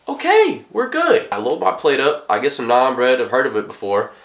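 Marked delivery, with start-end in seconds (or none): 0.1-1.3 high, bright burst of relief; mock cheerful okay after the all-clear; relieved excitement. Telling the boss it's fine, in high relieved excitement.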